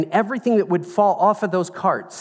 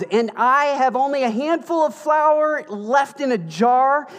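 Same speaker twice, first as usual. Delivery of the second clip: exasperated widow; 9 semitones higher, 6 dB louder than usual. Retold or imitated. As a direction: plead your poverty, high and indignant. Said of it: "And look what happens".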